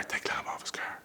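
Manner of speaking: whispered, no voice, hurried; urgent whisper. Retold or imitated. The boss quietly telling staff to remove the clamp, urgent whisper. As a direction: hiss the order out of the side of the mouth.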